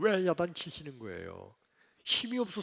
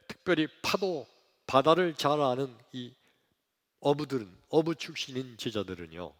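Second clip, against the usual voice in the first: ordinary delivery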